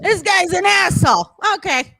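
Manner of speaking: high-pitched mock dismissal; mock reaction. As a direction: toss it off high, as an acted aside. Mimicking the reaction to loud locals.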